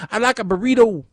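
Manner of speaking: high, lightly voiced soccer mom; prim suburban mom. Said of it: "soccer moms going".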